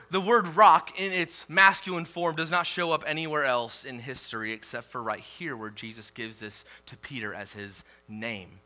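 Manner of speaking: usual speaking voice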